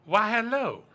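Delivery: high, loud in-character hello; blacksmith's greeting. Acted. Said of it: "She looks at you and says".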